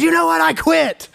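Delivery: high, excited quitting cry; defiant outburst. Retold or imitated. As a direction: blurt it out like storming off. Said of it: "he goes".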